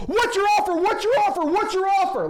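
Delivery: high, loud mocking yell of an impatient seller; ridiculous seller. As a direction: bark the question over and over like a pushy caller. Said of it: "you're gonna get ridiculous people".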